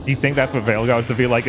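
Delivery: ordinary delivery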